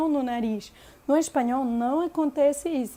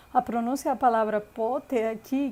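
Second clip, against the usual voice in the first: normal talking voice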